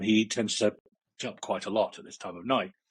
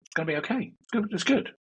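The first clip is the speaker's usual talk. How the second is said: rising higher into a reassuring puppet voice; lovely little chap; 10 semitones higher, faster than usual. Acted. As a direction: soothe like a little puppet friend. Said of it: "this lovely little chap just saying".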